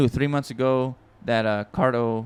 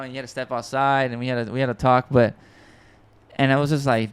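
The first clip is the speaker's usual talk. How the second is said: normal talking voice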